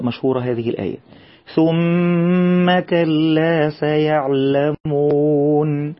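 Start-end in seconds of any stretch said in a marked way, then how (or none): 1.5-5.9 very slow chanted recitation, doubled meem held; Quran recitation. Shown as a demonstration. Teacher models the verse for children, slow chanted recitation. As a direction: chant it slowly as in tajweed class.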